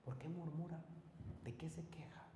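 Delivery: soft, breathy-free hushed whisper; whispered question. Acted. A pastor whispering the question to a murmuring listener. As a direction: lean in and whisper it.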